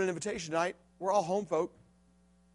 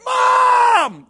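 high, loud holler across the rink; shouting for Mom; 14 dB louder than usual. Retold or imitated. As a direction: call out across the room. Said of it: "I hollered over and said".

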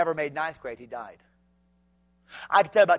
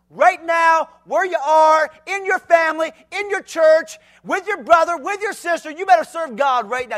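higher and louder, each phrase pushed; fiery preaching; 10 semitones higher, 10 dB louder than usual. Acted. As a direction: drive the call home. Urging the youth.

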